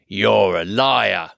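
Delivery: slow sulky troll. Acted. William answers Bert slowly.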